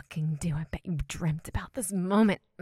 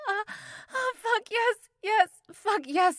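very high, very loud cries of pleasure; climax cries; 13 semitones higher, 6 dB louder than usual. Acted. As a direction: cry out at full pitch as you peak.

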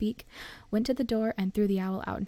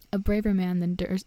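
plain reading voice

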